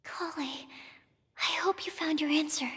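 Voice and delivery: quiet name, then a voiceless whisper; self-talk whisper. Acted. Collei steeling herself before answering, whispered.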